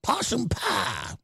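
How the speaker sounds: put-on a touch of an old country granny; mock granny. Host mocking a put-on folksy granny voice. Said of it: "Talking like Granny".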